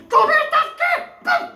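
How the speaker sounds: high-pitched shrill cry of a silly heckler; a high-pitched mock shout; squeaky high mock shout. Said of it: "somebody goes".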